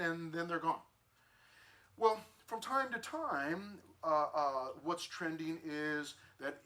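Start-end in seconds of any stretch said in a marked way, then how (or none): none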